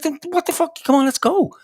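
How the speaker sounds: a high, pushy exclamation of disbelief; urging his friend out of the ward, high and exasperated; incredulous urging. Retold or imitated. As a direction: squeak it out, then hurry him along. Retold urging friend to leave.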